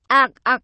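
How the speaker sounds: choking gasp. Acted. Vũ being throttled by Ngọc, strangled gasp. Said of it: "vừa đè vừa bóp".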